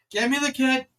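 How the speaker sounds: loud, high shouted command; urgent order. Acted. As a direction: yell for equipment in an emergency. Doctor demands medical kit urgently.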